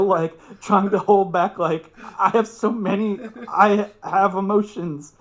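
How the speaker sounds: ordinary talk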